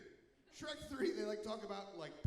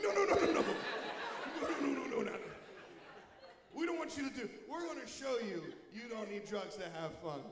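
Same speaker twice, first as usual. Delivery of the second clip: panicked backpedal; 5 dB louder than usual. Acted. DARE officer panicking at the kid, loud frantic no's. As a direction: rush to take it back in alarm.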